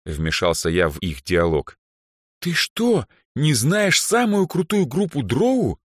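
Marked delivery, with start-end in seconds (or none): 2.4-5.8 high, slow, appalled disbelief; aghast girl, drawn out and high; shocked. A narrator's reading. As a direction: stretch the question in shock. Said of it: "В шоке уставилась на меня Даяна".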